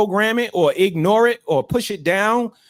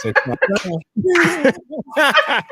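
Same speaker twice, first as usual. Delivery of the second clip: higher, very slow and laughing; laughing drawl; 8 semitones higher, much slower than usual. Real, not acted. Host echoes the husband's line, laughing. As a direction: stretch each word out slowly through a laugh.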